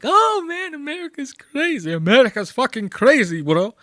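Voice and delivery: high, loud put-on exclamation; mock high-pitched outburst; imitating Ari. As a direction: overdo the amazed act to mask it. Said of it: "trying to mask it up, like".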